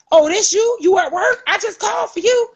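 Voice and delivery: mother confronting the deputy who beat her son; confrontational. Retold or imitated. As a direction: throw it back at the deputy.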